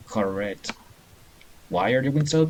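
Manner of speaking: ordinary talk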